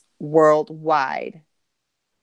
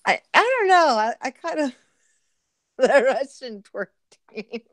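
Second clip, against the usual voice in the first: laughing; 6 semitones higher than usual. Real, not acted. Laughing at chat joke.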